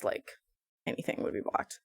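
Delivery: soft, breathy whisper to herself; whispered aside. Real, not acted. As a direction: mumble it under your breath. Muttered aside while searching.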